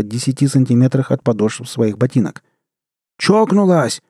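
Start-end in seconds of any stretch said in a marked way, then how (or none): 3.2-4.0 high-pitched startled yell; outraged yell. A narrator's reading. Man yelling at the woman who shot near him, outraged. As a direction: cry out in outrage, pitch jumping up. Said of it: "заорал он".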